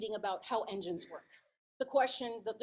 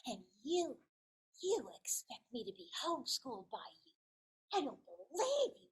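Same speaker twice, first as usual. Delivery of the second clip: put-on indignant child. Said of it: "he just looked at me and he said".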